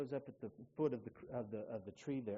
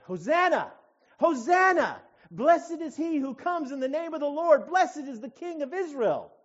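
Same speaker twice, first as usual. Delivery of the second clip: loud, high, ringing acclamation; crowd shouting praise; 13 semitones higher, 17 dB louder than usual. Acted. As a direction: cry it out to someone arriving in triumph. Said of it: "they begin to shout".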